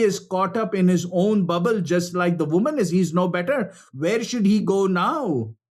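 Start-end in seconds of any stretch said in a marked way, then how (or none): none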